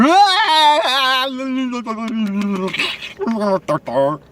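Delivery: long hammed-up nonsense wail that drops into jabber; mocking gibberish howl. Vlogger mocking crazy people who won't hear logic, high gibberish howl. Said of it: "They just understand".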